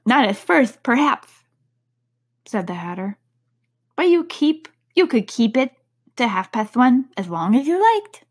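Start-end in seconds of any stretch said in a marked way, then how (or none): 0.0-1.4 Hatter voice. A narrator's reading. The Hatter speaks. 4.0-8.2 Hatter voice. A narrator's reading. The Hatter speaks.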